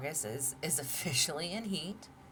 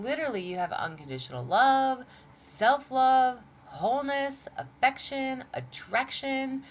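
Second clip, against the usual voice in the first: higher and louder, stretching each card; incredulous listing; 10 semitones higher, 7 dB louder than usual. Real, not acted. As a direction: list them as if it's too good to be true. Listing cards with mounting disbelief.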